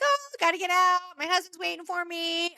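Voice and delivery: high, singsong clueless-tourist act; dumb tourist. Retold or imitated. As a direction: play dumb in a high voice to slip away. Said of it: "playing the role of, like, dumb tourist".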